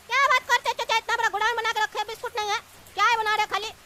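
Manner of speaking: ordinary talk, nothing marked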